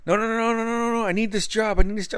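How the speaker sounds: high, whiny imitation of the friend begging; hammed-up desperate coworker; mock pleading. Friend pleading about his job.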